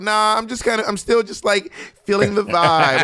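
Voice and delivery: mock noncommittal. Teasing a housemate who has not decided, mock noncommittal. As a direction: stall in a high mock voice, drawing out the end. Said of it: "Patty's still gonna be like".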